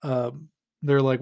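ordinary talk

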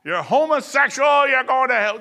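high, loud condemning street preacher; mock hellfire street-corner yell. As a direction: lampoon a harsh street preacher. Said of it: "we try to stay away from all the".

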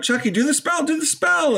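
high-pitched pleading; rising mock freak-out; mock panic. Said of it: "Tiffany starts freaking out".

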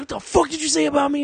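high-pitched, hammed-up confrontation; mock furious demand. Kimbo confronts Zach over the trash talk, mock furious. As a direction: confront them like an offended fighter.

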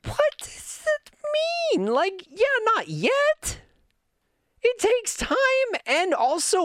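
high, squeaky disbelief; exasperated. Real, not acted. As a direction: cry out in mock despair. Exasperated rant to chat.

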